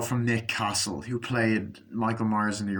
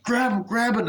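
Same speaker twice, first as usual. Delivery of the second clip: high urgent repeated shout; hammed-up frantic yelling; mock yell; 10 semitones higher, 3 dB louder than usual. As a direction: urge him on, frantic. Character yelling during attack.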